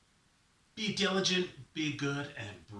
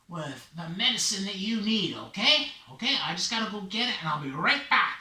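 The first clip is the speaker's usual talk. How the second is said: lively, lightly voiced promise; confident Raven; 7 semitones higher, 6 dB louder than usual. Raven promises to fly back with medicine, lively confident reassurance. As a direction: reassure them firmly before leaving. Raven takes his leave.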